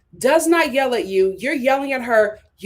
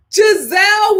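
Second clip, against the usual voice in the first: much higher, louder "Gizelle"; emphatic call; 9 semitones higher, 5 dB louder than usual. Real, not acted. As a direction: call her name out high. Calling out name emphatically.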